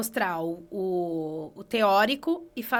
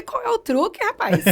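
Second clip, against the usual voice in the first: amazed retold question; 11 semitones higher, 5 dB louder than usual. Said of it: "Eu falei".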